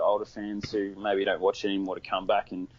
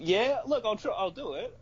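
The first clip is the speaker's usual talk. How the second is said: mock bravado; 11 semitones higher than usual. Mock bravado about fighting.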